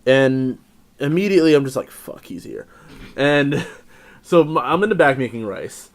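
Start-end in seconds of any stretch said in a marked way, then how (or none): 1.9-2.7 whispered curse. Retold or imitated. Panicked aside as boss arrives.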